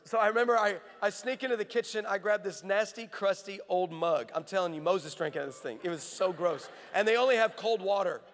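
usual speaking voice

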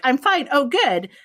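high, loud mock brightness; hammed-up everything's-fine voice; fake cheerful. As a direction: put on a chirpy fake smile. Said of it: "fake face on of".

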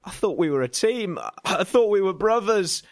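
high, strained protest; coughed out. A narrator's reading. Pinned narrator appeals to his wrestling partner, coughed out high. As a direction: choke out a pleading protest, pitch climbing. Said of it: "I coughed".

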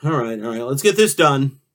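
normal talking voice

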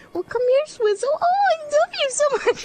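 high, gushing pet-owner coo; baby-talk to a beloved cat; cat mom voice. Pretend. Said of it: "you have to do cat mom voice".

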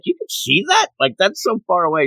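high incredulous question; retold astonishment. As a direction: echo someone who can't believe it. Bulma astonished at Goku's eyesight.

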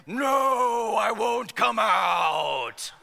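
a loud, slow, growling possessed woman; deep guttural demon voice. Retold or imitated. Possessed woman in the living room refusing to leave, deep guttural shout. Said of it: "in this deep guttural voice say".

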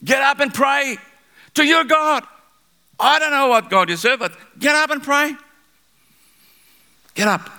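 very high, urgent shouted order; captain's command. Retold or imitated. Captain ordering sleeping Jonah to pray, shouting. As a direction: rouse him to prayer, voice pitched right up. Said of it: "he said, the captain".

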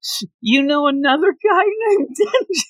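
high, hammed-up puzzled question; imitating a clueless guy; mock confusion. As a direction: play dumb in a squeaky voice. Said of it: "I want him to say".

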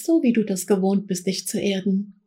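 guided meditation. Acted. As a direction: guide them gently into trance. Leads a guided trance journey.

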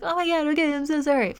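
high, put-on timid voice, gushing sorry; meek apology. Mocking the meek artist apologising to her boss, high and timid. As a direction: play the scared employee grovelling. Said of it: "She's like".

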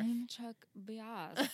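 very drawn-out, affected self-introduction; Chuck Bass. Retold or imitated. Co-host mimicking Chuck in the interview, drawled and affected. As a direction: stretch the name out in mock self-importance. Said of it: "And then Chuck just goes".

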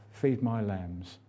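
tenderly entrust the flock, slowly; gentle command. Retold or imitated. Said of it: "He said".

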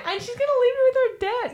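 very high, fast squeaky panic; mocking her own debt, high squeal. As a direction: rattle it off in a squeaky fright. Partner joins the mock panic.

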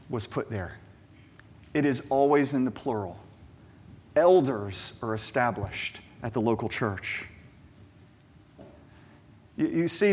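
ordinary talk

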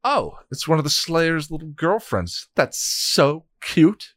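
high, slow sing-song mockery; mocking. Hell god sneering at the little witch, mocking. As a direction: coo it with fake sweetness.

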